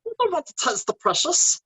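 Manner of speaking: very high creature impression; Gollum. Shown as a demonstration. Showing off an impression on request, high Gollum voice. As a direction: pitch it way up for a small, strange creature. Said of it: "give us a quick Gollum".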